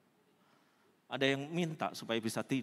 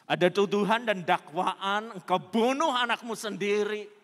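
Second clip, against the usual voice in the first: loud, raised preaching cry; thundered accusation; 6 semitones higher, 10 dB louder than usual. Acted. Preacher voices accusation of killing her child, thundered accusation. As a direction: hurl it out as a condemning charge.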